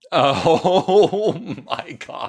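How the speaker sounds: high, loud, drawn-out laughing exclamation; laughing hard. Real, not acted. Friend reacts to the bank story, helpless real laughter. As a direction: crack up loudly through the words.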